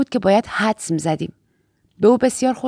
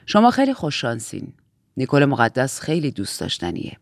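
low, measured aunt; calm low-pitched superior; composed Aunt Lydia figure; 7 semitones lower than usual. Acted. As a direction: deliver family news with lowered, composed authority.

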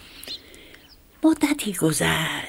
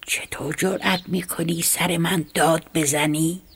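plain reading voice

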